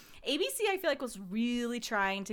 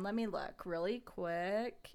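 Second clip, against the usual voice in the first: usual speaking voice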